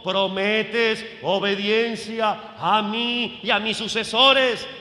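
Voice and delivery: slow, loud ceremonial questioning; weighty, drawn-out formal demand; bishop's solemn question. Acted. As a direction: intone it like a bishop at an ordination. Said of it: "el obispo me preguntó".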